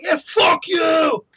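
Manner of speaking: high, loud, drawn-out mock-furious yell; Todd Howard raging. Developer slapping the controller mid-demo, hammed-up furious yell. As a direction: interrupt with a huge slow bellow. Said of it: "he slaps the controller in there".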